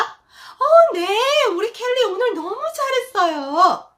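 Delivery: hammed-up breezy tutor brushing off a parent; mocking teacher's reply. Teacher mocking a tutor's empty reply to a mother, high and sugary. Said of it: "그러면 선생님 대답?".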